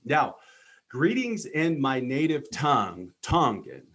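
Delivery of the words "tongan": ordinary talk, nothing marked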